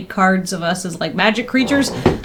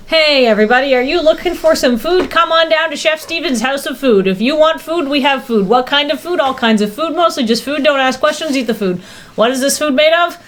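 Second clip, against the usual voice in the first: ad read voice; 4 semitones higher than usual. Acted. Reads Chef Steven sub commercial.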